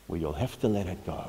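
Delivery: usual speaking voice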